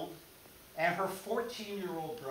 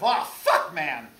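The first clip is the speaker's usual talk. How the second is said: loud, pitched-up cry of dismay; dismayed curse; 10 dB louder, slower than usual. Pretend. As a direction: curse as if the game is lost. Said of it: "this was the story of".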